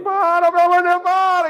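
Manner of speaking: high, loud panicked scream; imitating a scared boy screaming at his father; terrified child screaming. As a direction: beg in terror like a crying boy. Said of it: "E o menino gritando, chorando".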